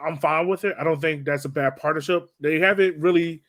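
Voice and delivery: ordinary talk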